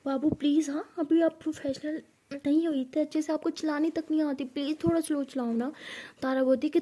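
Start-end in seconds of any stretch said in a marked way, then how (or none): none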